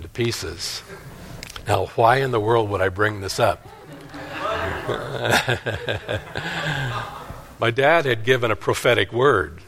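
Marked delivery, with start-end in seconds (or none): 4.1-7.3 chuckle. Real, not acted. Preacher amused by his own hog story, chuckling. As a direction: chuckle softly to yourself.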